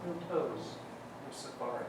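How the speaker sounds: very quiet, low reading of fine print; small print. Retold or imitated. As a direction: shrink it down like tiny writing. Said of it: "small writing".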